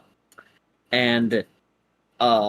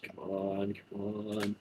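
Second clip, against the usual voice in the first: very soft, tense under the breath; anxious muttering; 4 semitones lower, 14 dB quieter than usual. Acted. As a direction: pace and mutter it to yourself. Ladon pacing, anxious.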